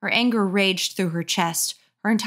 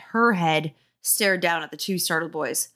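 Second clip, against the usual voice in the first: ordinary delivery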